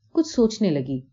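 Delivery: usual speaking voice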